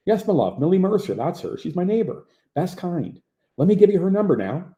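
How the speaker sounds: light Newfoundland lilt, pitched a bit up; a friendly Newfoundland operator, lightly voiced; Newfoundland accent. Narrator voicing the Newfoundland operator who calls him my love, light Newfoundland accent.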